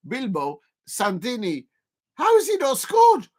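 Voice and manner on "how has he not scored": disbelief. Real, not acted. Near miss reaction.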